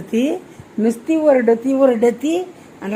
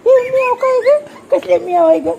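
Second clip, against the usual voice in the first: high, louder teasing call; mock surprised call; 14 semitones higher, 4 dB louder than usual. Mother teasing her daughter about the cat, high and playful.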